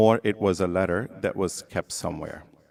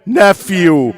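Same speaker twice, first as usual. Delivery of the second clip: loud, raised preaching voice; impassioned sermon; 8 semitones higher, 12 dB louder than usual. Acted. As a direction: retell it as a fervent lament, pressing on key words. Preacher retells the Karbala dialogue with force.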